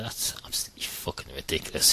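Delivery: normal talking voice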